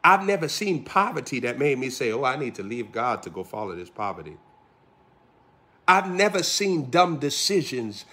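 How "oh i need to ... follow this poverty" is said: lower, softer mocking voice; mock foolish quote. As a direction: mimic the fool dismissively.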